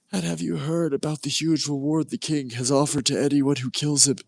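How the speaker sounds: high, excited soldier chatter; gossiping soldiers. Acted. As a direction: spread the rumour eagerly. Soldiers gossip about the reward.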